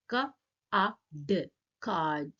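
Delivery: "K", "A", "D" as separate sounds, then "card" whole. Shown as a demonstration. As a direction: break "card" into K, A, D, then blend it. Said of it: "diacritic form क्या लिखने का".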